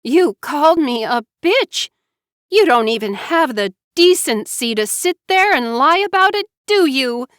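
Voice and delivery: high, loud furious yell; 愤怒地大喊. A narrator's reading. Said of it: "She was no longer yelling".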